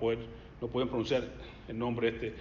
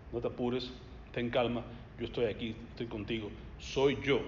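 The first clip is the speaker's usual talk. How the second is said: soft comfort; 5 semitones lower, 7 dB quieter than usual. Acted. Preacher voices Jesus comforting.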